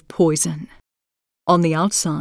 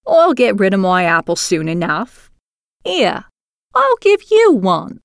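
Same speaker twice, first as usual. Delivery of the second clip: put-on sly old woman voice. A narrator's reading. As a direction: tempt her like a sly witch. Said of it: "replied the peasant woman".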